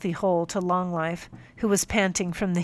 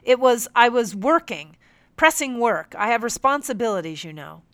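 higher, louder, defensive protest; indignant; 4 semitones higher, 6 dB louder than usual. Acted. Captain listing his responsibilities, indignant protest. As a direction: defend yourself, offended. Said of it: "he retorted".

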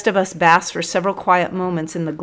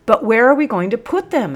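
higher, louder corporal voice; anxious subordinate asking; 6 semitones higher, 6 dB louder than usual. A narrator's reading.

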